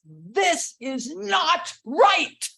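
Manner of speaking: high, loud, slow protest; big angry voice. Shown as a demonstration. Showing big voices for anger at injustice, slow loud outcry. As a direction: hammer out each word in a big demonstrated outrage. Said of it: "big voices".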